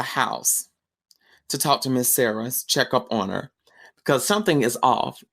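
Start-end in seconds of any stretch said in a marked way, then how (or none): none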